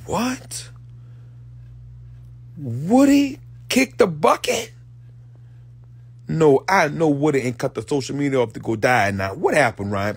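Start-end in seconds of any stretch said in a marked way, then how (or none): 0.0-4.7 high, stunned disbelief; can't believe it, rising; disbelieving. Acted. Hearing Woody died, stunned high disbelief.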